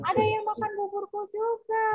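a light mama bear voice. Acted. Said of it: "Kata Mama Bear".

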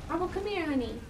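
high, soft pet-talk; coaxing a pet. Real, not acted. Owner to shy pet Marble, tender coaxing. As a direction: lure them over sweetly in a high, soft voice.

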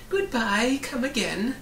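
high, loud witch's farewell call; shouting. A narrator's reading. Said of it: "shouted the witch".

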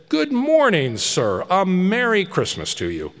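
high friendly passer-by; good-humoured fellows wishing Scrooge merry Christmas; cheery greeting. Acted. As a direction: hail him merrily in the street.

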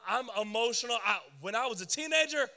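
ordinary talk, nothing marked

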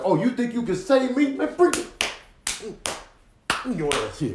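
high mocking dare; taunting challenger. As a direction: egg him on, hammed up. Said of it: "Beginning was like".

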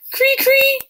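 piped falcon call. A narrator's reading. Sam calling to Frightful, a high piped cree. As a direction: pipe it like a falcon. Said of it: "he piped".

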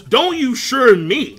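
loud, slow mock scold; mock Big Mom snap. Big Mom scolding Jinbei, mock slow loud scold. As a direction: scold like an angry giant mother. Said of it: "Then she goes".

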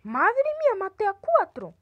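high loud triumphant cry; excited shout. Real, not acted. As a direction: cheer the big kill. Quadruple kill celebration.

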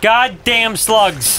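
high, slow, drawn-out cursing; frustrated outburst. Real, not acted. Player cursing game enemies, frustrated outburst. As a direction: curse the enemies word by word.

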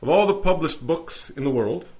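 loud, formal courtroom address; courtroom oration. Acted. Lawyer presenting the Bible to the judge, loud orator. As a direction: project it to the whole court.

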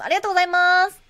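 bright shop-counter thanks; cheerful cashier thank-you; clerk thanks. Acted.

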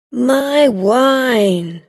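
lightly voiced dismay; crying out. Drunk man seeing his bottle break, mild cry. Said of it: "the man cried".

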